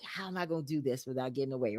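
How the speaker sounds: low, very soft, rapid musing; thinking to oneself. Retold or imitated. Host voicing the guest's secret plotting, quiet and quick. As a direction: imitate private scheming under your breath. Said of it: "thinking it over in your head".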